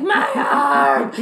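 loud mock scream broken by laughter; laughing mock scream. Scientist's arm broken by the snake, loud laughing scream. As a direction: yell it but crack up.

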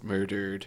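"murdered" without moving the face on the last syllable; proper pronunciation. Shown as a demonstration. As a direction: hold the face still on "murdered". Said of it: "your face from the last syllable".

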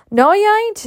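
Mammy objecting. Acted. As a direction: up in arms, cut her off.

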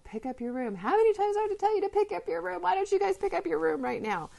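nagging shout that climbs out of control; exasperated yelling parent. Shown as a demonstration. Coach acting the parent who yells at kids about their room. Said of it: "So if you notice you're about to yell".

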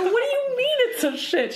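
very high, disbelieving retort; high-pitched reenacted "what on earth?" reaction; incredulous outcry. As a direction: act out total disbelief in a high squeal. Acting out own incredulous reaction.

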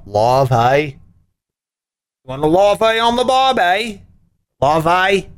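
clumsy, exaggerated Australian impression; bad Australian accent. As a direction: do a badly faked Aussie accent. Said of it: "as they say in Australia".

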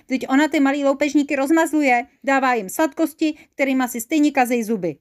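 high, loud squabbling; acted outburst of a bickering devil; quarrelsome complaint.

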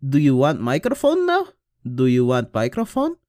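high, loud mock boss voice; imitating the hiring lady. Hiring lady offering the mic, in a high, loud mock voice. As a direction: put on a goofy high voice for her. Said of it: "she will be like".

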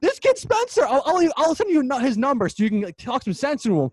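high, loud exasperated rant; driven crazy. Real, not acted. Host vents about his friend's comparison, driven crazy. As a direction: complain as if at your wits' end. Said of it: "it's really been driving me crazy".

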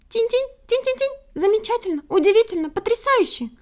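very high chirping bird voice; thrilled, high-pitched titmouse twitter; overjoyed. A narrator's reading. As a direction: chirp with delight, then gush. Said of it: "обрадовалась".

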